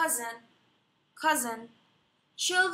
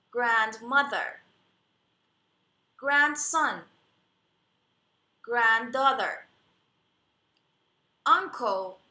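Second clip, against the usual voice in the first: slow, loud and clear word drill for the class; teacher repeat-after-me drill; 2 semitones lower, 4 dB louder, much slower than usual. Shown as a demonstration. Teacher models each word for students to repeat, clear and loud. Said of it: "با من تکرار بکنید".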